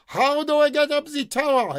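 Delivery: high, loud amplified call; shout through a loudspeaker. Acted. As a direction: blast it through a megaphone. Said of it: "got the loud inhaler again and shouted".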